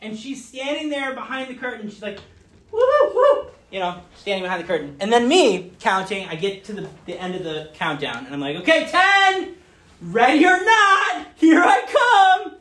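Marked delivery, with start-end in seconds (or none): 2.7-3.4 mock giggle. Daughter giggling behind curtain. 8.6-12.6 much higher, slow, loud sing-song call. Retold or imitated. Dad calling out ready or not to his hiding daughter, loud and high.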